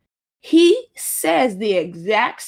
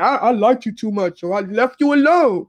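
put-on dopey man's voice, swelling louder; mocking stupid excuse; 3 dB louder than usual. Mimicking men's excuses for leaving, mocking. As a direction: mimic his excuse with scorn. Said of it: "some stupid shit like that".

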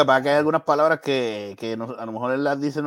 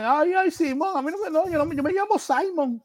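a much higher whiny mocking voice; 13 semitones higher than usual. Making fun of Latinos who anglicize their names, high whiny voice. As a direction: whine it high like a snob.

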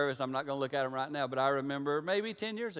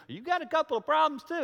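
mock defensive retort; 10 semitones higher than usual.

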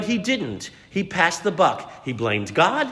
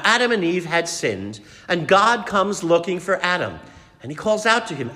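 ordinary delivery

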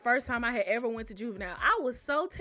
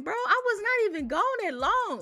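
incredulous; 8 semitones higher, 4 dB louder than usual. Retold or imitated. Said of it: "I'm like".

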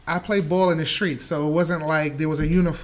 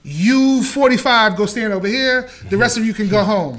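louder, barking out the cut like a tryout coach; mock coach call; 4 semitones higher, 7 dB louder than usual. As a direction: call the cuts across the gym. Mimicking tryout coach calling cuts.

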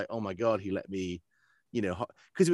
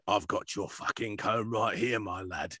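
rough bandit; slower than usual. Acted. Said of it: "a bunch of guys in the same room saying".

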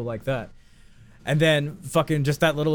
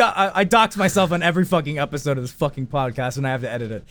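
normal talking voice

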